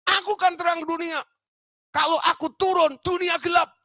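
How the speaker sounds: very high shouted proclamation; patient's answer. Retold or imitated. The patient tells the doctor why he stays up, high shout. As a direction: declare it like a madman. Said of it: "Bilang gitu".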